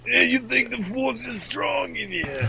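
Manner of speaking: put-on high, slow New York-accented alien; Watto. Alien doubting the Force, hammed-up New York accent. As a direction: drag it out in a hammed-up alien dealer voice. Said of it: "I picture Watto from Star Wars".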